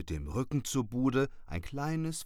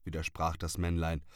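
usual speaking voice

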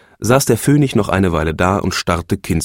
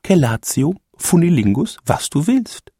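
hohe, lockende Frauenstimme; die Phönigin; 10 semitones higher than usual. A narrator's reading. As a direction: biete es ihr verführerisch an. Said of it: "sagte die Phönigin". (die Phönigin lockt ihren Mann, hoch und verführerisch)

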